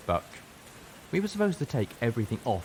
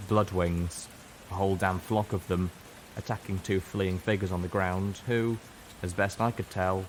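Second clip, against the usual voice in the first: usual speaking voice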